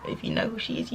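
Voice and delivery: breathy secretive whisper; whispered hint. Pretend. Hinting at a secret person.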